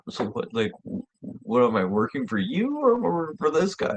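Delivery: confused. Retold or imitated. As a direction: retell it as baffled questioning. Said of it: "like, confused".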